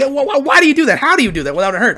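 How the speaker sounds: lightly voiced shocked onlookers; amazed friends. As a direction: play the baffled partygoers. Friends' reaction to party trick.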